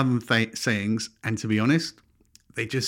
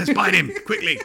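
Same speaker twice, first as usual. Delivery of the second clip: bug voice; 10 semitones higher, 5 dB louder than usual. Retold or imitated. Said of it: "It's like".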